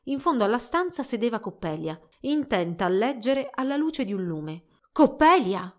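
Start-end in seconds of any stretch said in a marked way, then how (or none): 4.9-5.7 tense, raised call; heart in mouth. A narrator's reading. Girl approaching her rival, heart in mouth, calling. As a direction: call out with nervous urgency. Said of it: "col cuore in gola".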